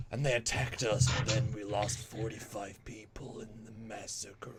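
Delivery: whispered, slow husky drama; gruff sexy. Retold or imitated. Host imitating actor's too-sexy line reading, husky whisper. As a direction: breathe it like a sultry movie hero. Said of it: "Like a gruff sexy".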